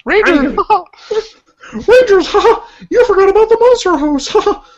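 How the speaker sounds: high Kermit voice breaking into laughter; squeaky frog puppet voice, laughing through. Acted. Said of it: "You do Rangers!".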